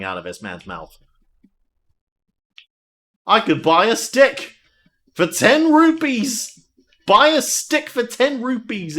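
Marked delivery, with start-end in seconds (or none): 3.2-9.0 higher, louder, slow showy announcement; mock excitement. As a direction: sell the stick like a bargain. Reads item text excitedly.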